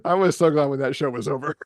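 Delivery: quick, laughing relief; giggling relief. Real, not acted. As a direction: laugh it out fast. Laughs recalling the bad show.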